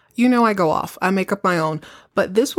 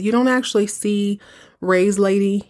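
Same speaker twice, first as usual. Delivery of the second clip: normal talking voice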